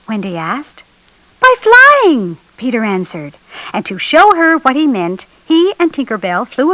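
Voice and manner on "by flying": put-on high, loud, excited boy's answer; excited exclamation. A narrator's reading. Peter proudly reveals how to travel.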